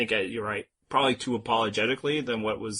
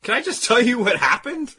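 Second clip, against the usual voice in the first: high, startled protest; flustered surprise; 11 semitones higher, 4 dB louder than usual. Retold or imitated. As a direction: squeak out a baffled protest. Mocking Tony's flustered reply.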